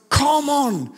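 high, hyped-up cheer; pumped-up rallying call; fired-up motivation. Acted. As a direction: cry it out like you can't be stopped. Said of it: "dann bist du so motiviert".

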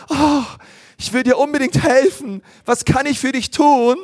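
high, sweet and eager; a tiny bird keen to help; eager little sparrow. Acted. Sweet sparrow offers the squirrel help, high and eager. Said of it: "schaut ... an und sagt".

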